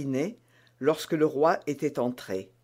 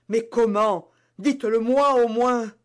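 higher, louder, begging; pleading queen; 6 semitones higher, 6 dB louder than usual. Acted. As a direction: beg to know how. Reine supplie. (reine suppliante)

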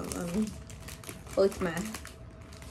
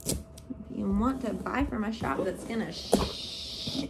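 usual speaking voice

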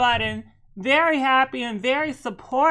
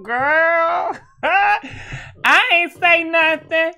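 laughing tease; 7 semitones higher, 6 dB louder, much slower than usual. Real, not acted. Teasing after hinting at gossip.

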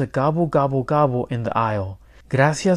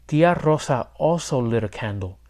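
usual speaking voice

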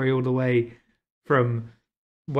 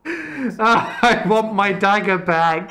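laughing whine; 5 semitones higher, 7 dB louder, much slower than usual. Real, not acted.

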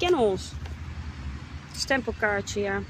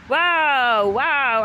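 loud wow; 6 dB louder than usual. Real, not acted. Exclaiming in disbelief.

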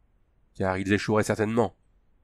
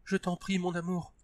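frightened plea; 8 semitones higher, 8 dB quieter than usual. A narrator's reading. Terrified Tania pleading.

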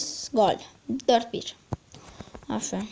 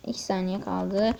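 usual speaking voice

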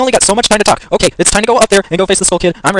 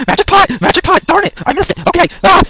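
frantic shout; 11 semitones higher than usual. Real, not acted. Frantic exclamation.

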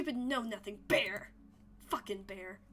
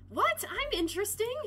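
surprised; 9 semitones higher, 5 dB louder than usual. Acted. Mock surprise at a roast.

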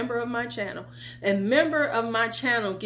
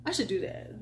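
ordinary delivery

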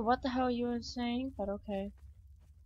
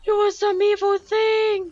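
frightened shout; 7 semitones higher, 8 dB louder than usual. Real, not acted.

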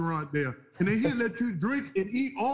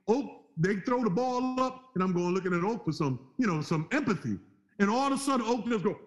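ordinary talk

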